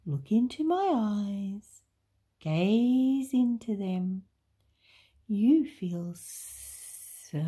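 slow, coaxing snake with long esses; slow storybook hypnotist snake; hypnotic Snake. A narrator's reading. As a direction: lull the listener, stretching words out.

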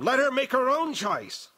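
high, insistent scream. A narrator's reading. Girl defending her aunt's choice against the demon, screaming. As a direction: cry out at the top of the range. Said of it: "Ghanima screamed".

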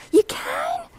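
high surprised squeak. Real, not acted. Surprised someone hears her.

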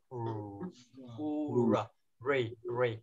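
U and Re said with rounded lips, the R sound isolated; rounded R drill. Shown as a demonstration. Teacher drills the R sound for the class. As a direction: pout the lips for the R. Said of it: "sẽ chu miệng một chút".

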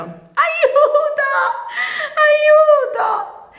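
shrill, very high, loud screams; terrified cry for help. Acted. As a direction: scream from the treetop, scared and hurt. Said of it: "gridò spaventata e dolorante". (grido di aiuto spaventato)

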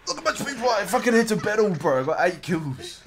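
loud, high bragging; hyped gamer bro. Real, not acted. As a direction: shout out your kill count. Bragging about kills after fight.

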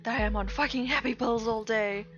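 mock angry outburst. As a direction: snap back at him, fast and indignant. Acted quarrel, her angry reply.

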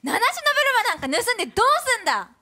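angry comedy skit rant. Acted. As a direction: grill him like an outraged comedian. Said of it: "完全コピーさせていただきました".

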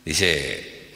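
normal talking voice

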